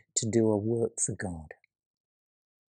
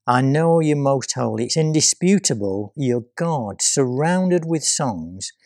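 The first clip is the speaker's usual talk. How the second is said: proclaiming; 6 dB louder than usual. Acted. Psalm proclaimed with force.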